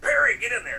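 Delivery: imitating the coach sending him in; mock coach call. Retelling the coach calling him off the bench, loudly.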